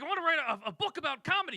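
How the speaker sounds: hammed-up older guy voice; older man. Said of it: "late fifties, early sixties".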